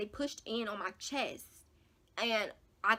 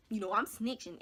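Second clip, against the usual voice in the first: usual speaking voice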